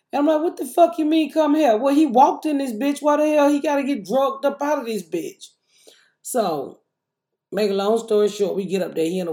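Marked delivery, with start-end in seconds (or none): 0.4-5.5 mock the staff's nonsense with disbelief; high indignant retold rant; outraged retort. Retelling outraged reaction at workplace.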